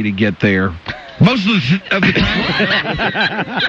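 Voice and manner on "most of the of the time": "time" dragged out, laughing; "of the time" slow with a laugh; emphatic. Shown as a demonstration. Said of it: "It's so emphatic".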